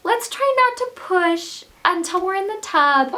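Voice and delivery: high, slow, sweet coaxing voice; sweet midwife. Retold or imitated. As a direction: gently talk her out of pushing. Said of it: "she's so sweet".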